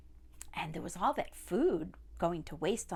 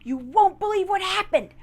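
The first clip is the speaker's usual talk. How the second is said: higher, louder panicked voice; terrified young robber; 11 semitones higher, 10 dB louder than usual. Acted. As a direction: gasp it out in fright. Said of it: "and he said to everybody".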